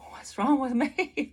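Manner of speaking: high, drawn-out mock bewilderment; bewildered. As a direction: wonder aloud, slow and high. Acting out her bewildered self at revival.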